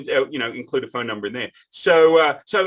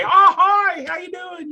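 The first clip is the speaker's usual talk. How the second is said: much higher, bright imitated hello, louder at first; mock cheery greeting; 5 dB louder than usual. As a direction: gush a friendly Nashville hello. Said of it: "people say".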